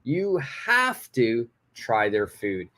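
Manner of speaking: slow, with "have to" drawn out; strongly recommend, leaning on "have to"; emphasis on HAVE TO. Shown as a demonstration. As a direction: lift and lengthen "have", then ease down. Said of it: "I emphasize the word have to".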